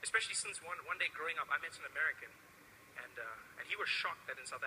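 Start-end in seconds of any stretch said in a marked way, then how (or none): none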